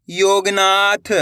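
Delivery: slow spelling. Shown as a demonstration. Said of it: "अब अपन direct मात्रा का उच्चारण करके पढ़ेंगे".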